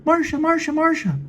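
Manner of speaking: very high falsetto woman voice; Jane's voice. Acted. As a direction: squeak it out high and sweet.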